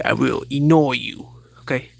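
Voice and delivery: ordinary talk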